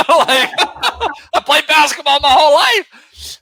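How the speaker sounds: laughing it out, high and loud. Real, not acted. Retelling meeting Jordan, laughing hard. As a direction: laugh through the words. Laughing at his own story.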